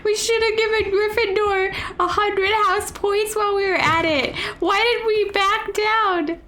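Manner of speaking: high, laugh-strained mock wail; tearful laughing. Real, not acted. Player regretting not awarding house points, wailing through laughter.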